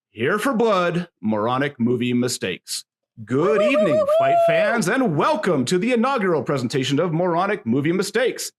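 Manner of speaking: high, hyped ring announcer; hammed-up wrestling emcee intro. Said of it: "I'm going to do it in my best wrestling announcer voice".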